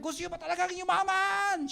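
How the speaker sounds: hype question to crowd. Shown as a demonstration. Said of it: "tatanungin mo yung crowd".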